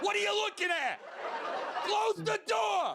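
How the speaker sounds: high, shouted mouse voice yelling back; a mouse snapping at the man opening the cabinet; mouse tough guy. Acted. Mouse caught in the cabinet, shouting high. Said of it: "I open the cabinet, like".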